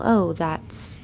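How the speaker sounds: ordinary talk, nothing marked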